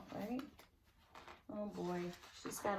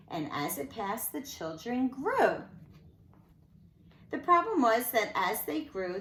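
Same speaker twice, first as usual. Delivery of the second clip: lilting rhymed read-aloud; sing-song storybook telling; story narration; 2 semitones higher, 7 dB louder than usual. A narrator's reading. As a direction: read it to children with bounce. Reads a rhyming picture book.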